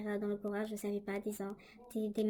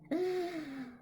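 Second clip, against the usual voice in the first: sharp high gasp as if scared again; frightened gasp; 6 semitones higher than usual. Retold or imitated. Retelling the woman at her bed, a sharp frightened gasp. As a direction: gasp at the memory of the ghost.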